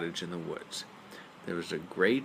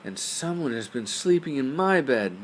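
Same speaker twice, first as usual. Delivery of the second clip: higher, louder mama bear; 6 semitones higher, 5 dB louder than usual. A narrator's reading. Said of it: "exclaimed in her medium-sized voice".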